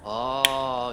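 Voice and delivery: ordinary talk, nothing marked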